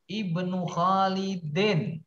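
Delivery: "Ibnu Khalidin" slow and high, ending in a clear "-in"; stressed kasrah ending. Shown as a demonstration. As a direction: draw out "Khalidin", landing on the i vowel. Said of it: "Yang kedua itu wajib kasroh".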